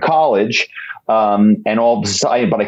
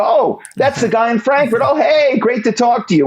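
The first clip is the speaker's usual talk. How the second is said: much higher, gushing recognition; delighted greeting; 12 semitones higher than usual. Acted. Firm staffer welcoming the guy in Frankfurt, acted high-pitched delight.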